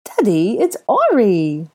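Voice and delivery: put-on high, loud, stretched-out little-boy voice; happy child's call; beaming child. A narrator's reading. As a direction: announce yourself joyfully to daddy. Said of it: "beaming up at the blonde man".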